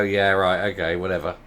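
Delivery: unimpressed. Retold or imitated. Civil servants brushing off the new secretary, low and bored. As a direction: brush her off, utterly unimpressed. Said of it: "they're all just gonna sit and look at her and go".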